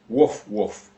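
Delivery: two short mock barks, woof woof; dog bark imitation. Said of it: "The dog barks twice".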